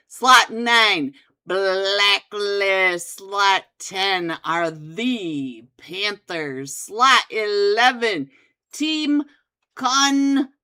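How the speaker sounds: loud hyped event announcer. Acted. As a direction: boom out the lineup like a host. Announces finals teams loudly.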